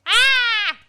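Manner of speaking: loud high-pitched "Ah!" scream; mock scream. Co-host acts out Madeline's finger being pricked, screaming. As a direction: let out a big piercing yelp. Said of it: "She screams".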